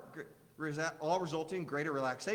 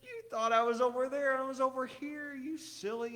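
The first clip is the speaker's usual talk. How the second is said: high, lightly voiced delighted child; teasing little-kid voice, pitched up; overexcited five-year-old; 7 semitones higher than usual. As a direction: imitate a kid tickled by his own prank. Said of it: "get so caught up and beh".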